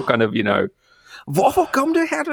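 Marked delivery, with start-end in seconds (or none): 1.3-2.2 very high scolding Danish; mock Danish crime-drama telling-off; Danish berating. Shown as a demonstration. Imitating Danish crime drama characters, berating in Danish. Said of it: "appear to be constantly berating each other".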